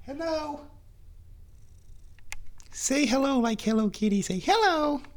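very high, cutesy kitty-talk voice. Real, not acted. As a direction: squeak sweetly to coax a cat. Coaxing the cat to talk.

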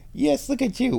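high cooing to a pet. Real, not acted. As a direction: coo it up high. Cooing at the cat.

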